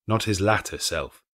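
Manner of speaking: ordinary delivery, nothing marked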